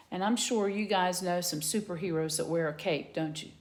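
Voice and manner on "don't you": ordinary delivery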